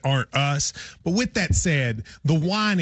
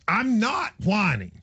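drawn-out, higher-pitched whiny protest; whiny mimicry, pitched up and dragged out; mock whining; 5 semitones higher, slower than usual. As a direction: imitate a whiner, stretching it out slowly. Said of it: "he did one of those".

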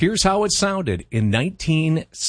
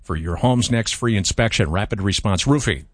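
sponsor ad read; 4 semitones lower than usual. Acted. Reads sponsor ad.

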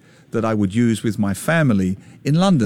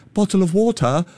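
mock posh Harry Potter British accent, higher pitched; put-on British accent; 7 semitones higher than usual. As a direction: imitate students faking a British accent. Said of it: "Why are you doing that voice?".

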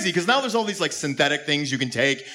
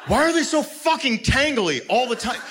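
high-pitched exasperated gripe; 10 semitones higher than usual. Acted. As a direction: whine in mock frustration at tangled earbuds. Exasperated rant about tangled earbuds.

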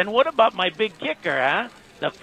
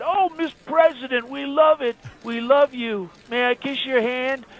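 high fawning gushing voice; fawning fan imitation; 7 semitones higher than usual. Trump mimics elevator fan, high and fawning. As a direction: gush up high like a flatterer. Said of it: "and he said".

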